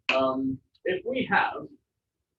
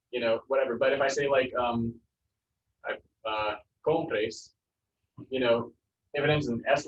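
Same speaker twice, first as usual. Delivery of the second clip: normal talking voice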